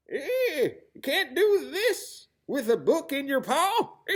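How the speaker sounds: high cackling buccaneer, laughing at him; pirate with a laugh. Acted. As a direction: chuckle through the line like a jolly old sea rabbit. Bartholomew Bunny speaks, laughing.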